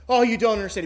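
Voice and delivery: a half-comic puppet breakdown; mock sob. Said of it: "He begins to sob".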